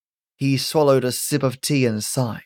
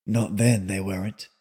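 soft, lower, sad reply; sadly; 5 semitones lower, 5 dB quieter than usual. Acted. Calvin corrects Melinda, quiet and sad. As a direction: let it sink quietly. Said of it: "said Calvin sadly".

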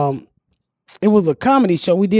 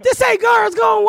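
mock shout; 12 semitones higher than usual. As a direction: shout it back at her. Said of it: "they was like".

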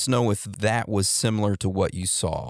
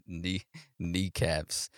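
quiet, lower words squeezed out between laughs; laughing echo; 3 semitones lower, 10 dB quieter, much slower than usual. Real, not acted. Host echoing the caller's odd sign-off, cracking up. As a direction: softly repeat it through real laughter.

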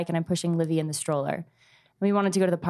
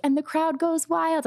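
high, excited play-by-play call, much higher; sports announcer; 10 semitones higher than usual. Retold or imitated. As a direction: hype it like a stadium announcer for a toddler on a bike. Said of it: "And I was narrating".

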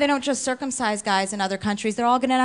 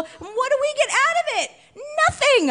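much higher, squealing indignation; indignant squeal; 12 semitones higher than usual. Real, not acted. Comic ranting about bridesmaid costs, squealing indignant. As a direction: whine it like a wronged bridesmaid.

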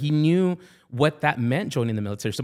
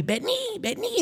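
much higher, slow calling voice; mock dad calling across the house; 5 dB quieter, slower than usual. Dad calling son over, high and slow.

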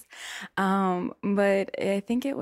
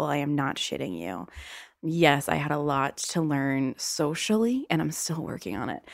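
usual speaking voice

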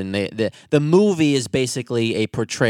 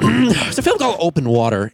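podcast mode; 6 semitones higher, 4 dB louder than usual. Shown as a demonstration. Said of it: "very podcasty".

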